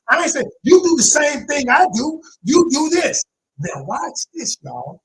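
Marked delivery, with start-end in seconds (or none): 0.6-3.2 higher, louder, pointed blaming; mock accusation. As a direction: act out a partner throwing blame. Acted quarrel, accusing partner.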